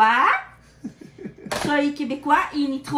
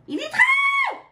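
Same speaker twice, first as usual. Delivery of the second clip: yelling across the room; 3 dB louder, slower than usual. Real, not acted. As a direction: shout the title high and loud. Shouting the title across the room. (crier le titre à travers la pièce)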